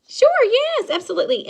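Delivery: squealing happy yes; eager customer. Retold or imitated. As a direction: play the delighted winner saying yes. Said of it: "And she says".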